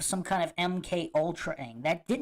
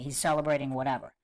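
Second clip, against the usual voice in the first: usual speaking voice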